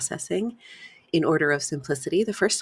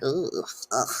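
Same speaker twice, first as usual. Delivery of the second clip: disgusted groan; 3 semitones lower than usual. Shown as a demonstration. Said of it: "be like".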